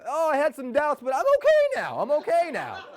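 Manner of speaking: mock-cheery brush-off; mock Luke. Luke reassuring ground control, mock high breezy reply. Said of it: "And Luke was like".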